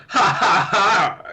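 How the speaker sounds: high, mock defiant laugh; acted laugh. Player laughing as he's punching her, put-on defiant laugh. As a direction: laugh off the punches in separate ha's. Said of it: "now I laugh as he's punching me".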